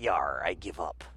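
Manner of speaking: mock gruff pirate. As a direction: slump into a lazy pirate drawl. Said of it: "who was like".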